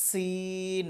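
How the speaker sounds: সিন with a long hissed s and held vowel; extra sibilant s. Shown as a demonstration. Teacher modelling letter sin, long sibilant. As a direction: stretch both the s and ee of সিন. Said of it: "এই উচ্চারণটা থাকবে".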